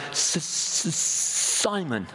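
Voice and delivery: stammering on the S before the name; a retold man with a stutter, catching on the first sound. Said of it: "I've got a stutter".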